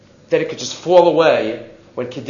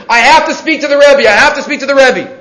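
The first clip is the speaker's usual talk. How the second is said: higher, louder urgent demand; retold screaming; 8 semitones higher, 6 dB louder than usual. Stranger bursting into the study hall, shouting. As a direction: burst in demanding to see the Rebbe. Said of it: "starts screaming".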